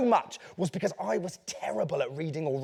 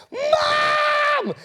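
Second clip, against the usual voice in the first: very high, long-drawn yell of "Mum"; child's scream for mum; 4 dB louder than usual. Acted. Boy at the top of the house yelling for his mum, piercing scream. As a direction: bellow it high and long across a big house.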